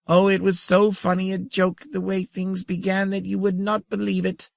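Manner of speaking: high, chittering tiny-creature voice. A narrator's reading. The grandmother teases Saren about the joke, high and chittering. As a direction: chatter like a giddy little insect. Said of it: "chittered the grandmother".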